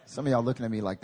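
ordinary delivery

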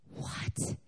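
stunned breathy what; shocked whisper. Retold or imitated. Mom hearing her daughter didn't graduate, breathless shock. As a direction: let the shock drain the voice.

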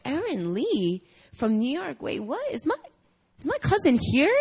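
acted double take, swooping up high; puzzled surprise. Cousin hears her relative's name announced, mock puzzled surprise.

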